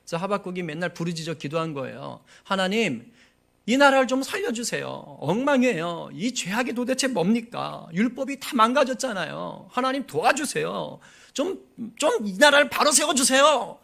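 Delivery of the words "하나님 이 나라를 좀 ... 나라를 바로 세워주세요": high, loud pleading cry to God; a desperate prophet's wailing plea; crying out in prayer. Retold or imitated. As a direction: beg God loudly and slowly, near tears. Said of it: "막 부르짖어 눈물로 기도한 겁니다".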